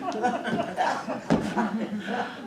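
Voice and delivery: loud rising laugh; burst of laughter. Real, not acted. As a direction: break up completely. Reporter breaks up laughing.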